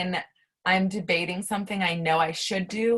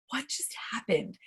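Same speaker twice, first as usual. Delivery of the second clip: hushed, near-whispered disbelief; stunned; 4 semitones higher, 6 dB quieter than usual. Retold or imitated. Coaching client after a powerful exercise, stunned near-whisper. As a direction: breathe it out, amazed and higher.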